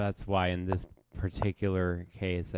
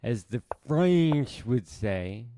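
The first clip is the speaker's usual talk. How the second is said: hammed-up French accent, higher and slower; mock French accent; 3 semitones higher, 6 dB louder, slower than usual. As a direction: play a jokey Frenchman savoring the word. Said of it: "that's my very good French accent".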